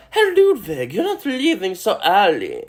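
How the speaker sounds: very high put-on woman's voice; high-pitched mock coaxing; acted stage character. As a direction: call after him in a high, playful character voice. Voices Fräulein Kost calling out.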